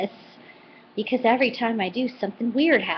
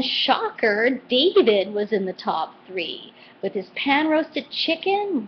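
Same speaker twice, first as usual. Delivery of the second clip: normal talking voice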